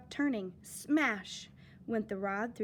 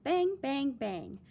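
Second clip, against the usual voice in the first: usual speaking voice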